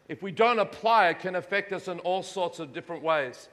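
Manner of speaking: usual speaking voice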